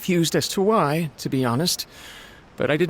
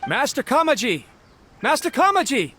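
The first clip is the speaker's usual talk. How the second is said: high, raised searching call; 10 semitones higher than usual. Acted. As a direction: call out for your missing master. Servant searching for missing master, calling out.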